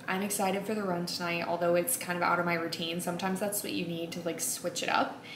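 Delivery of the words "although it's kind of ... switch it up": ordinary talk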